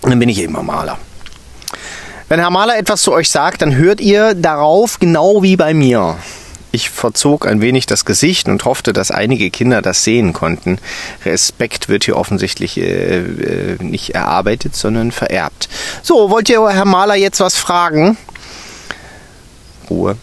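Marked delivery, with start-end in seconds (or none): 2.3-6.2 high and loud, laying down the rule; bossy teacher instructing children; stern teacher. A narrator's reading. Stern teacher telling children to obey the intern. 16.0-18.1 high, loud and bossy; teacher prompting the class; stern teacher. A narrator's reading. Stern teacher prompting the children to ask questions.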